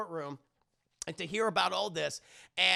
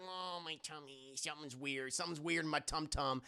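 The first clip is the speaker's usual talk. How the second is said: soft, lower queasy mumbling; mock sickly; 4 semitones lower, 10 dB quieter than usual. Heroine after vomiting, soft mock-sickly complaint. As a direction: play poorly with a babyish tummy ache. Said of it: "feeling, uh, oh, so, uh, sick".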